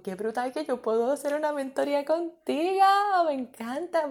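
high, delighted squeal of words; gushing self-quote. Retold or imitated. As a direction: gush to the listener, pitch soaring. Re-enacts thrill at mentoring a listener.

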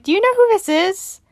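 high, outraged question; indignant Obrecht. Retold or imitated. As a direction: play the shocked doctor demanding. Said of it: "Doctor Obrecht is like".

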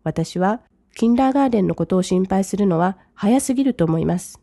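ordinary delivery

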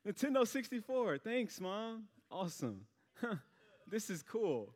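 forced enthusiasm. Retold or imitated. Faking gratitude for wrong gift.

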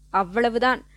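ordinary delivery

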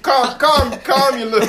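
high, loud, laughing protest; hammed-up telling-off through laughter; mock exasperated comeback. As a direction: playfully yell it, cracking up. Acted comeback to the coworker.

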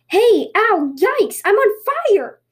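high, loud tanuki yelping in alarm; alarmed screaming. Acted. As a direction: yelp as if on fire, stretching the last word. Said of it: "ran down the mountain screaming".

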